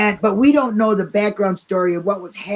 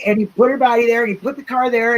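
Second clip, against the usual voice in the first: ordinary talk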